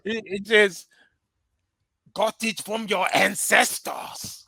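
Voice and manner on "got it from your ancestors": slow, deadpan mock African accent; exaggerated African accent, dry; bad African accent. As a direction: drag it out in a hammy African accent. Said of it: "our bad African accent for dramatic effect".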